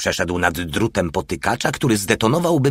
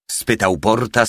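ordinary delivery, nothing marked

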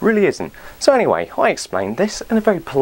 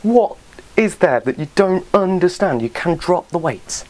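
ordinary talk

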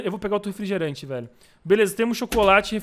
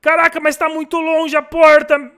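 panicked; 7 semitones higher, 8 dB louder, slower than usual. Real, not acted. Panic at the distant door.

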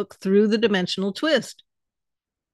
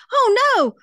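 a high, loud cry of dismay; anguished cry; 13 semitones higher, 6 dB louder than usual. Acted. Polly crying out as her cat vanishes into the shrubs. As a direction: cry out as the cat leaps from your arms. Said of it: "Polly cried".

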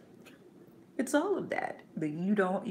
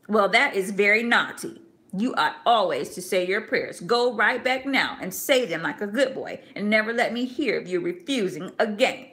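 louder, stern mother's rebuke; stern scolding; 4 semitones higher, 7 dB louder than usual. Acted. Mother scolding her son for refusing prayers, loud and stern. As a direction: scold him firmly like a strict mother.

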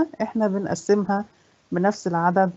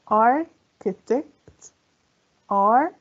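"Architect" very slowly as ar, chi, tect; long gaps between the three parts of "Architect"; syllable by syllable; 4 semitones higher, much slower than usual. Shown as a demonstration. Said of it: "كم عدد المقاطع".

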